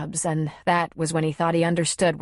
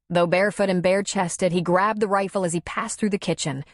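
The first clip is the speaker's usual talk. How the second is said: plain reading voice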